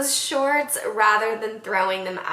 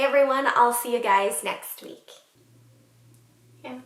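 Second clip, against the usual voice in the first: ordinary talk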